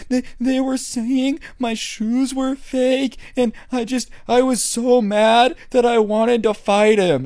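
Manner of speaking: high, slow whiny sobbing voice; crying kid. Retold or imitated. Crying kid explaining himself to the coach, high teary whine. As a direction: whimper it out like a kid in tears. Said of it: "through tears in his eyes, is still crying".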